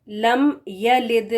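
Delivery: slow, careful yalid with clear ya; correct Quranic reading. Shown as a demonstration. Teacher models the correct Quran reading slowly. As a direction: reads lam yalid slowly, stretching each sound. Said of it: "اس کی آواز ہونی چاہیے".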